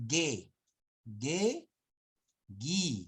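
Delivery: "ge, gi" with hard G and silent U. Shown as a demonstration. Teacher drilling the contrast, demonstrating. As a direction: sound out ge and gi without any W. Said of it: "no es we".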